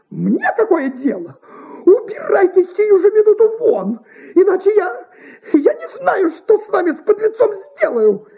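high, loud, furious actress; indignant outburst. A narrator's reading. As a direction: order an intruder out in outrage. Actress orders intruder out, threatens.

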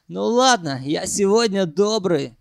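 ordinary delivery

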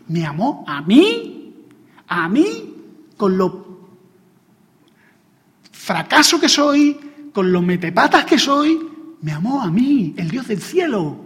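fervent preaching. Acted. Preacher on God's love despite his failures, moved and rising. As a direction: marvel aloud at being loved.

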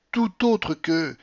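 a touch of mock theatrical verse; parodying Corneille. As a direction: ham up a classical tragic line. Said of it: "en parodiant Corneille".

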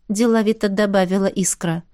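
usual speaking voice

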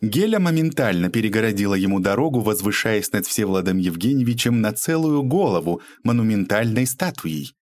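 usual speaking voice

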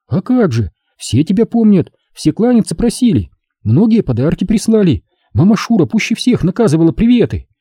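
put-on high girlish voice passing on greetings; Lyuba, ardent girl, eager. A narrator's reading.

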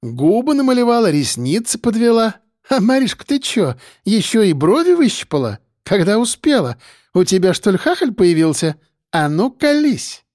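high, nosy, teasing market gossip. A narrator's reading. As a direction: needle her friend, high and eager.

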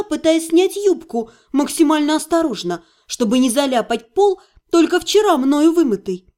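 ordinary delivery

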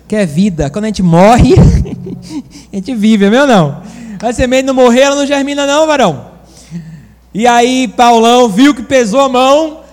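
loud, rousing preaching with a laugh in it; full-force preaching. Acted. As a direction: lift the congregation up.

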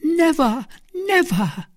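very high, earnest protest; emphatic, high-pitched cry of denial; convincing denial. A narrator's reading. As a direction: swear it with absolute conviction. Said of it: "accent that admitted no doubt".

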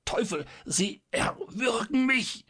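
very high, slow choking voice; squeezed, desperate choking protest; strangled cry. A narrator's reading. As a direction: gasp it out while being throttled. Doctor is being strangled.

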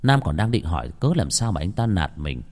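ordinary delivery

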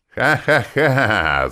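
high, loud, slow demonic laughter; evil cackling laugh; hellish cackle. Acted. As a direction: cackle like a devil. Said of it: "захохотал ... адским смехом".

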